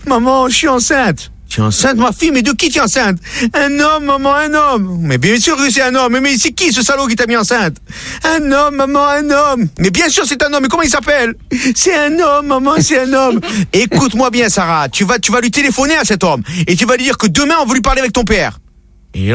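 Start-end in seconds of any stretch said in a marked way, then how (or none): 0.1-1.3 high, drawn-out tearful calls; a daughter crying to her mother; mock wailing. Sarah cries to her mother about being pregnant, high and wailing. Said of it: "en pleurant". 1.5-18.6 high mother and daughter voices. Acted.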